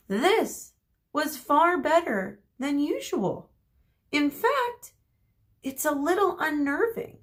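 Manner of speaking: uneasy narration. A narrator's reading. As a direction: let the calm feel eerie.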